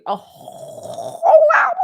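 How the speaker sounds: high, loud, slowed disbelief; surprised. Real, not acted. Host shocked at how long the segment ran, exclaiming. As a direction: stretch it out in disbelief.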